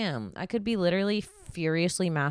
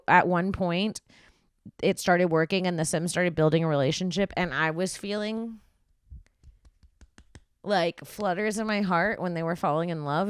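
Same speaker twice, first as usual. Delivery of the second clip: ordinary talk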